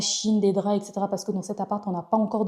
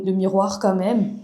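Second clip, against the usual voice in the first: normal talking voice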